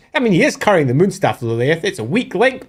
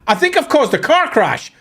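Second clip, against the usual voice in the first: higher, hammed-up dawning alarm; mock realization; 10 semitones higher than usual. Lilith realizing she caused the crash, mock alarm. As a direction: suddenly realize the mistake, hammed-up. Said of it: "she goes".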